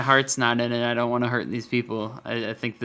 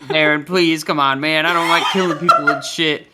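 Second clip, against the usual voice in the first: pleading; 2 semitones higher, 4 dB louder than usual. Acted. Pleading with the enemy.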